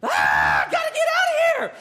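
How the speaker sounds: loud panicked shriek, pitch leaping high; screaming like little girls. Acted. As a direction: flee shrieking in mock fright. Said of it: "start screaming like little girls".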